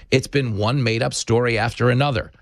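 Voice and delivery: ordinary talk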